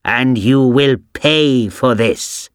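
put-on slow, drawn-out old witch accusing; angry witch. A narrator's reading. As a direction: point the bony finger and spit it out slowly. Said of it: "she said angrily".

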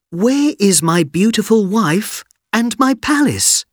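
high, loud worried cry; upset boy. Acted. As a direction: cry out in dismay. Aladdin distraught.

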